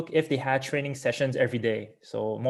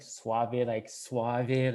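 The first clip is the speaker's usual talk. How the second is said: mock-smooth; 4 dB quieter, much slower than usual. Swimmer puzzling over the coach's word suave, mock-smooth. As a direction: savor the word quietly, as a joke.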